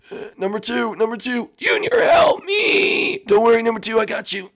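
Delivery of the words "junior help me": very high, slow, crying scream; robot in flames screaming; crying high shout. Acted. Number two on fire.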